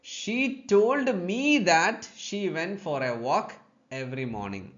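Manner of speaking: very slow, word by word example read; deliberate teacher reading; slow dictation. Shown as a demonstration. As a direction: lay each word out for the class. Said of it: "Look at the next sentence".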